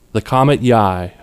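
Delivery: usual speaking voice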